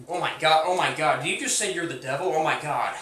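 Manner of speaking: usual speaking voice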